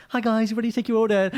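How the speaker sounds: chirpy server asking for the order; mock waiter voice. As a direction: waiter greeting the table, hammed-up cheerful. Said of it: "the person would be like".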